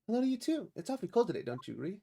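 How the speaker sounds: high, bright-eyed assistant voice; perky girl. Acted. Perky assistant greets the witness, high and chirpy.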